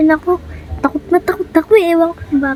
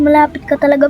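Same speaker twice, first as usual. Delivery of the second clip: ordinary talk, nothing marked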